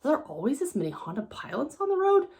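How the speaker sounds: hushed, puzzled wonder, acted out; mock disbelief. Imagined driver marvels at all the Honda Pilots, mock disbelief. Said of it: "you're gonna be like".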